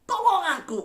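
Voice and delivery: high, loud cry for help; desperate acted shout, pitched very high; Peter crying out. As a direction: call out to the Lord as if drowning. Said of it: "Petrus berteriak".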